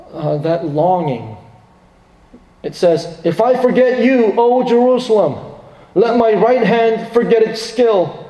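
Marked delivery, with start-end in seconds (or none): 3.2-8.2 higher, fervent scripture reading; impassioned psalm recital. Acted. Preacher reciting Psalm 137 to the church, fervent and high. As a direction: read the psalm as a lover's vow.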